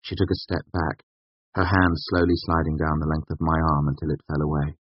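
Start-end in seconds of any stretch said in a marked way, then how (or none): none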